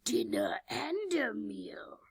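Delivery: breathy monster whisper, slow; hungry sea monster. Retold or imitated. Sea Prince eyes its dinner, slow monster whisper. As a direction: hiss it out like a hungry beast. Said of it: "The Sea Prince is like".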